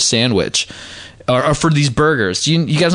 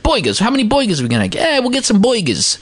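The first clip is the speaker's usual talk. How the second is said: mock Philly accent; 8 semitones higher than usual. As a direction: ham up the Philly ad guys' accent. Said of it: "over and over, it's like".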